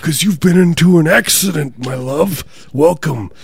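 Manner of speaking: lilting, hammed-up welcome to the beyond; welcoming afterlife spirit. Spirit greeting a crash victim, theatrical welcome.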